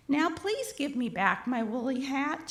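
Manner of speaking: ordinary delivery, nothing marked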